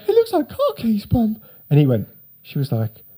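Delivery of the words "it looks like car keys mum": put-on much higher, innocent boyish voice; mock naive teen. Son telling mum about keys, high innocent voice. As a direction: play the wide-eyed kid, pitched way up.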